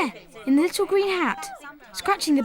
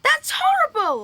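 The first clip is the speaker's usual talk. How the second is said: horrified outcry; 9 semitones higher, 4 dB louder than usual. Acted. Boy horrified at ant roasting.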